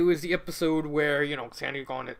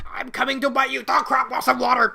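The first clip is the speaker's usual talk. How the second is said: spider voice; 7 semitones higher, 4 dB louder than usual. Acted. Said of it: "It's like".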